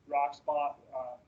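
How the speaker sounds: ordinary talk, nothing marked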